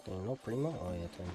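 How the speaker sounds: ordinary delivery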